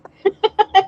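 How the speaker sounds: high, loud burst of giggling; laughter. Real, not acted. Woman cracking up over cheater versus cheetah, laughing high.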